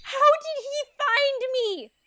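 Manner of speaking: high, slow-stretched dismay; panicked. Acted. Ella learns the prince found her, panicked. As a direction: drag out the horrified question.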